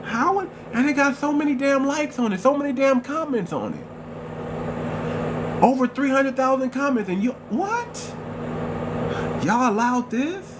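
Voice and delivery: high-pitched, stretched-out disbelief; incredulous outrage. Retold or imitated. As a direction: let the disbelief climb high and drag the last words. Indignant at a platform double standard.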